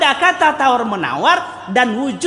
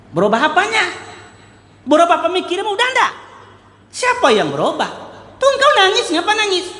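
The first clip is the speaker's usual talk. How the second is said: taunting king; 11 semitones higher, faster than usual. Acted. King mocking the weeping prisoner, high and taunting. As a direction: jeer at him for crying.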